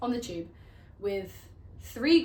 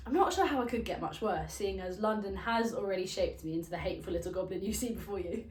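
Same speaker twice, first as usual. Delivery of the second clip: normal talking voice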